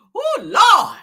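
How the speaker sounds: loud, high cry of disbelief; shouted oh Lord. Real, not acted. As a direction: throw your head back and shout it.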